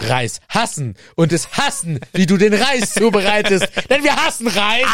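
starts plain and ends in a high, loud mock rant; mock rant building to a shout. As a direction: whips itself into a hammed-up outburst.

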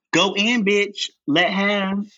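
high, sassy hype; hyped-up drag cheer. Retold or imitated. Noah hyping LaChance at the vanity, high and sassy. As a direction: pump her up with sass. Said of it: "and he says".

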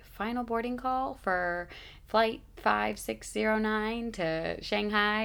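slow, lightly imitated gate announcement; boarding announcement. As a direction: announce it like a PA speaker. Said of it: "I hear them say".

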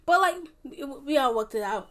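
ordinary delivery

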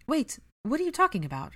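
startled worry, wanting answers now; concerned. A narrator's reading. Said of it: "Hermione asked with concern".

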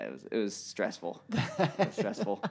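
words breaking into laughter; laughing. Real, not acted. Storyteller looking back on the scare, real laughter. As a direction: laugh through the admission.

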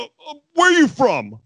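high, loud mock blurt after stammering; Taz. Nervous commentator challenges the guy next to him, imitated panicky high blurt. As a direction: burst out in flustered panic. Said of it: "watching Taz freak out".

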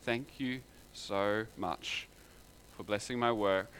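slow, soft, grateful; humble thanks. Retold or imitated. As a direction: offer it timidly and thankfully. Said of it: "Abel came to God saying".

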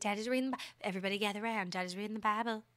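hushed mock Southern dad, a touch of accent. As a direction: softly announce it like a Southern patriarch. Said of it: "why we did a Southern accent".

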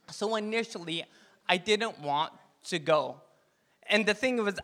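ordinary delivery

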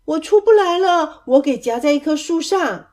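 high, loud call from afar. A narrator's reading. Cousin stuck in a tree, calling down. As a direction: shout down that you're stuck.

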